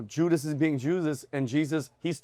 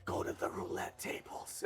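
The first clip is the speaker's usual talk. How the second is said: slow mysterious whisper; voice in his head; 17 dB quieter, slower than usual. Acted. Inner voice guides man in the lobby, whispered. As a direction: whisper the command slowly. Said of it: "Voice comes back in his head".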